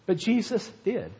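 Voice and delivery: normal talking voice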